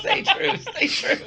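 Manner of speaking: breathy laughing high call; squeaky laughing pirate order. Retold or imitated. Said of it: "you're like".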